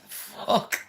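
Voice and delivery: breathless laugh then a whispered curse. Real, not acted. Reacting to Megan's letter with a whispered laughing curse.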